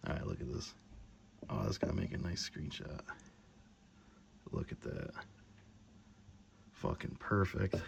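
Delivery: soft, low whisper. Real, not acted. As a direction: hush it out. Hushed admiration of the result.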